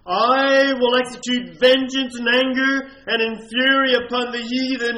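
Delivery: preacher re-reads the verse with full force; preached at full force. Shown as a demonstration. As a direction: thunder it from the pulpit. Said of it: "In verse fifteen".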